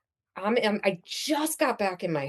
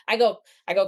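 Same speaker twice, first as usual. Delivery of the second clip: ordinary talk, nothing marked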